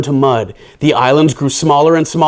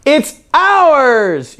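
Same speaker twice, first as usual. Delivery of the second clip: high, lilting triumphant voice; Lydia's proud line; 11 semitones higher than usual. A narrator's reading. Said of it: "she said".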